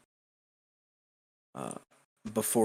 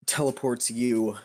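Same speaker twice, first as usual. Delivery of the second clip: ordinary talk, nothing marked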